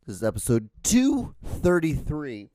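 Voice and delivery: slow, announcer-style episode read; lightly put-on announcer voice.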